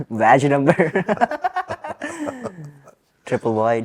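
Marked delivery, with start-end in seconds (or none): 0.8-2.7 high-pitched laugh breaking out; sudden high laugh; high burst of laughter. Real, not acted. Laughing at badge number joke.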